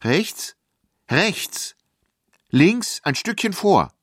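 put-on high child giving directions; eager kid steering a crane; directing. A narrator's reading. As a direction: call out each direction high and separate. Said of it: "dirigierte ich".

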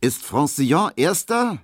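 loud, high-pitched eager question; calling out. A narrator's reading. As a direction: call it out urgently. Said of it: "rief er dann".

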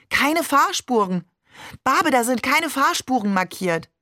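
high, amazed exclamations; excited calling out. A narrator's reading. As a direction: call it up high to dad. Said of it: "rief der Seestern".

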